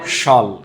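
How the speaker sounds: শল said as one short syllable, shol, with no ia. Shown as a demonstration. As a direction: clip শল to shol, drop the ia. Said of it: "এগুলাকে কি বলতে হবে?". (শল উচ্চারণ, শিয়াল নয়)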